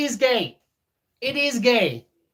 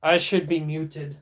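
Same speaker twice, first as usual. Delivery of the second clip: ordinary talk, nothing marked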